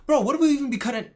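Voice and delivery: high, loud and fast disbelief; shocked cry of disbelief; incredulous outburst. Real, not acted. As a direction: blurt it out, stunned.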